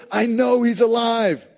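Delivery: high, loud, slow insistence; excited certainty. Retold or imitated. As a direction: insist joyfully to the doubter. Disciple answers a doubter.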